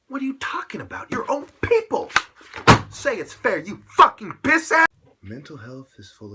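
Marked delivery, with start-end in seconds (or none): 0.1-4.9 screaming, much higher and louder; furious shout. Real, not acted. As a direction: explode at him with full rage. Furious outburst at critics.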